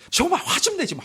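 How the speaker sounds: mock spousal outburst, high and loud; 부부싸움 흉내. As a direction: imitate a fed-up spouse, pitch jumping up. 부부싸움에서 배우자 말 흉내. (부부싸움하듯 높고 크게 짜증 내는 흉내)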